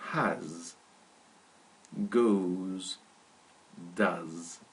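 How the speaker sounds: has, goes, does each said slowly and clearly; has, goes, does as a teacher's model; careful pronunciation. Shown as a demonstration. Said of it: "Listen carefully to the pronunciation".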